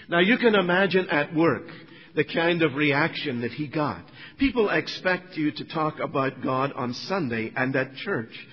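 ordinary talk, nothing marked

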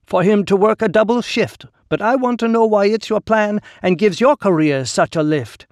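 high, sly dealer voice; smug trader voice. Acted. Nylarin character voice.

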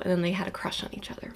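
hushed, fast gossip whisper. Real, not acted. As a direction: whisper it like a secret. Gossip about a crush.

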